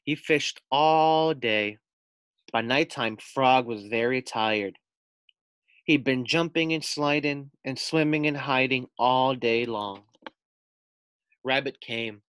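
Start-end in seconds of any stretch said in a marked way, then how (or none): none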